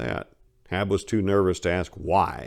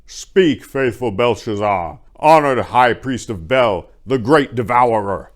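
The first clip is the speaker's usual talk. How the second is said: booming king; 4 semitones higher, 9 dB louder than usual. A narrator's reading. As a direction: address the whole city from a high platform. Cyrus answers the high priest.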